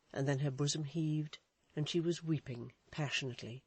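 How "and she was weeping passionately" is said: ordinary delivery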